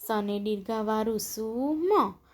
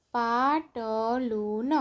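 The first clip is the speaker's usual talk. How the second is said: પાટલું stretched slowly, each syllable held; drawn-out પાટલું, syllables separated; slower than usual. Shown as a demonstration. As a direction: sound out પાટલું slowly for beginner readers. Syllable-by-syllable reading, then whole word.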